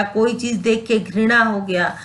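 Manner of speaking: ordinary talk, nothing marked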